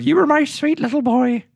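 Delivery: put-on high, slow baby-talk mom; doting mother. Mother cooing at her grown son, high baby talk. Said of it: "something along the lines of".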